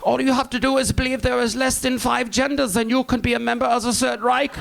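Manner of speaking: lightly voiced old German man; a hint of an elderly German veteran; old German accent. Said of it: "They'd be like".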